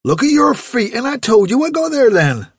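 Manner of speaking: a father scolding his barefoot daughter. Acted. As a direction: tell her off for her feet.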